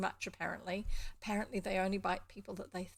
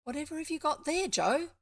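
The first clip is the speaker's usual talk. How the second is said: eager cry, higher and quick; excited cry; 8 semitones higher, 6 dB louder than usual. A narrator's reading. Three children question the farm boy about his hidden bundle, excited cry. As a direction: cry out with high-pitched curiosity. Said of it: "cried three voices at once".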